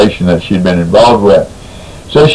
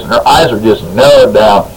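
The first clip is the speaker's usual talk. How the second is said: normal talking voice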